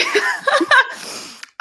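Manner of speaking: high teary laugh; emotional laugh. Real, not acted. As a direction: let out a choked-up laugh. Host laughs after being moved.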